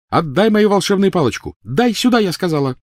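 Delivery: put-on furious girl. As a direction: spit each word out with a blow. Hermione demands her wand.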